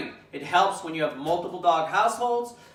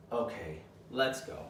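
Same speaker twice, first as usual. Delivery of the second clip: low, soft okay let's go; relaxed quiet release; calm and assertive; 6 semitones lower, 10 dB quieter than usual. Shown as a demonstration. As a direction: invite the dog out calmly. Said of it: "Calmly and assertively".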